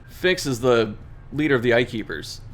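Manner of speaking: ordinary talk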